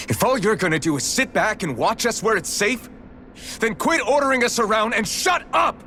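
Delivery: rising from sharp to a full angry shout. Acted. Fed up with the observer, he builds to a furious shout. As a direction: build until you yell the last words.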